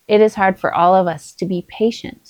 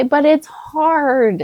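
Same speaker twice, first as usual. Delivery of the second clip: put-on whiny kid; 7 semitones higher, much slower than usual. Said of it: "And they'll say".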